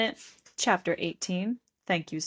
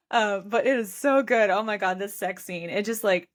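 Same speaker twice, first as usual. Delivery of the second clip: ordinary talk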